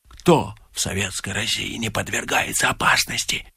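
a frightened woman whispering about Soviet danger; fearful whisper. Acted. Fortune-teller speaks of danger.

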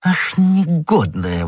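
stretched, scandalised outburst; exclaiming. A narrator's reading. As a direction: draw it out in indignation. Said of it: "воскликнул комиссар".

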